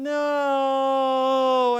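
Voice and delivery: put-on toddler's tragic no; despairing no. Shown as a demonstration. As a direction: wail it like the end of the world. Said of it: "sounds like the end of the world".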